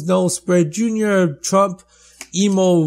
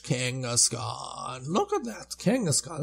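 ordinary talk

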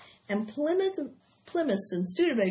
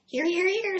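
goose honking it out, much higher; 10 semitones higher, 3 dB louder than usual. Acted. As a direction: squawk it like a fussy goose, high. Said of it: "said the goose".

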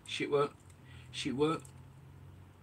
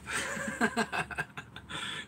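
breathy laugh; 9 semitones higher than usual. Real, not acted. Laughing at friend's wife.

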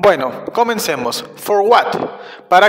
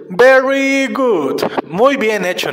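slow, higher-pitched "very good"; drawn-out praise; 3 semitones higher, slower than usual. Real, not acted. Teacher praising children after the lesson, slowly drawn-out praise.

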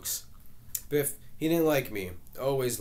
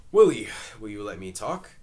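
exasperated; 9 dB louder than usual. Acted. Argument at the family table.

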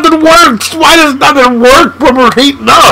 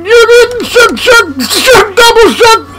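high, excited crowing; hyped gamer gloat; gloating taunt; 12 semitones higher than usual. Real, not acted. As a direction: rub it in their face. Taunting the opponent.

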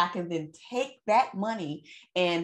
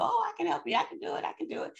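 high eager voice fading to a whisper; eager helper voice; 11 semitones higher, much faster than usual. Retold or imitated.

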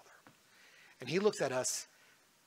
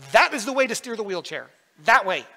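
put-on child demanding, louder; 6 semitones higher, 11 dB louder than usual. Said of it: "She'd be like".

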